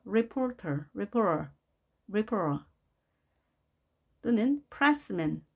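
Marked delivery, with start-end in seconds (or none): 0.1-2.7 reporter with a soft r sound at the end, ripora. Shown as a demonstration. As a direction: glide softly through reporter with an unstressed ending. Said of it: "reporter 이렇게도 부-, 부드럽게 발음이 되죠".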